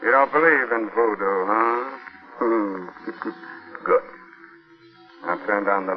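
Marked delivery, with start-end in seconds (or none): 2.4-3.3 sinister chuckle. Acted. Host mocks the doubting listener.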